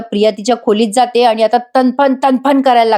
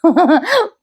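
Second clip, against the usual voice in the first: short high laugh; high, light giggle; amused laugh; 7 semitones higher than usual. Real, not acted. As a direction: laugh at the tearful act. Amused by the retold crying.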